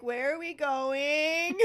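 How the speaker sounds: slow, high whiny voice; whiny Harry. Retold or imitated. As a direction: drag out a whiny question. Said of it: "Harry's just like".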